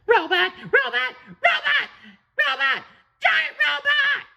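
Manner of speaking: very high panicked shouting; yelling in panic. Acted. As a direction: scream the warning as you run.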